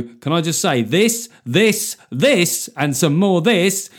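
mock politician rallying the studio audience; rousing soundbite. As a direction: build it like an applause line. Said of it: "Whereas if you go".

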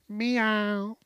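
high, drawn-out pretend meow; house cat meow. Pretend house cat staying inside, high meow. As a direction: mew like a pampered indoor cat.

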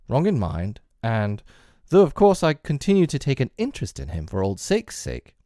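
high, swooping voice of an opinionated doctor; emphatic, theatrical disapproval; hearty Doctor Lanyon, indignant. A narrator's reading. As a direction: play a boisterous gentleman airing a grievance.

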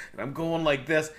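ordinary talk, nothing marked

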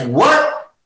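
high, rising one-word question; retold astonishment. Retelling Gary's reply from the stairs, high-pitched astonishment. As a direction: jump up in pitch, taken aback.

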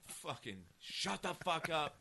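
high-pitched, drawn-out mock outburst; retold lashing out. Comedian retelling an overreaction to someone ordering a drink, lashing out. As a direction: act out losing it at a noisy customer. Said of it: "I used to lash out fast".

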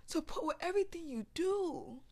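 normal talking voice

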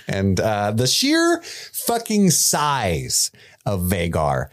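ordinary delivery

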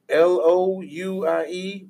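slow, separated letters; spelling it out. Shown as a demonstration. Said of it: "How you spell that?".

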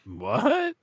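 high, rising startled question; acted surprise. Dad answers his daughter's alarm, acted high surprise. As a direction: replay a puzzled dad's double take. Acts out father's startled reply.

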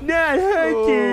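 loud, high, slow pitchman shout; hammy infomercial pitchman yell; Billy Mays. Acted. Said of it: "Billy Mays".

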